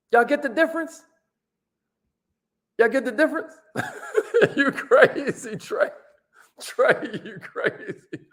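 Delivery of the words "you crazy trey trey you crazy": laughing. Real, not acted. Laughing at the cameraman.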